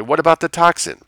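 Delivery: high, loud protest; alarmed shout; crying out. A narrator's reading. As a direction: burst out in alarm. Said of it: "Lessing cried".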